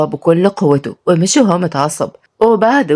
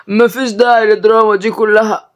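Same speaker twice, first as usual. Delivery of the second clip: dismissive; 5 semitones higher than usual. Acted. Brother dismisses the drama.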